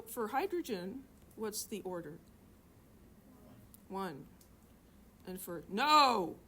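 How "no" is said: sudden, drawn-out mock yell; real loud no. Teacher playfully correcting a student before the answer comes, loud yell. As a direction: burst out much louder, stretching the word. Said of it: "could have said no real loud".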